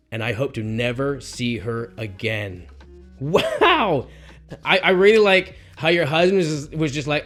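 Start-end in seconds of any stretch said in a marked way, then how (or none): none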